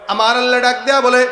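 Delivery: full-force preaching. Acted. Allah summons the servant to judgement, full-force preaching. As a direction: call it out to the whole congregation.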